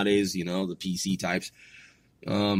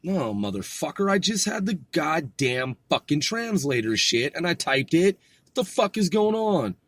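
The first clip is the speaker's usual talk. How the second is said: climbing higher in frustration; exasperated; 6 semitones higher than usual. Real, not acted. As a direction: cuss out the misbehaving translator. Exasperated at translator failing.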